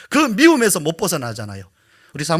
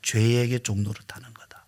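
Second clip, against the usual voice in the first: a weighty pulpit whisper; hushed whisper; 5 semitones lower, 5 dB quieter than usual. Real, not acted. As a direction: drop to a whisper. Sermon point dropped to whisper.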